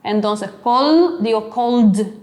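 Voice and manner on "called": "called" as one syllable ending in a voiced d; "called" with a marked d after the l. Shown as a demonstration. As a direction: release the final d of "called" audibly. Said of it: "No necesito poner una D".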